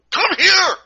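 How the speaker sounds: sudden high loud yell; furious teacher yelling at student; shout. Retold or imitated. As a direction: bark it out to the kid.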